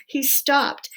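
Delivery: usual speaking voice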